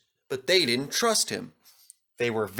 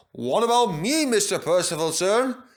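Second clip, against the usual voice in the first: put-on eager old engine; 6 semitones higher, 3 dB louder than usual. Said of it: "said a voice".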